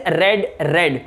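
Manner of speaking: रेड said like "red", short e; रेड as in the past of read, rhymes with bed; past tense red. Shown as a demonstration. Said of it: "इसको भी हम रेड पढ़ेंगे".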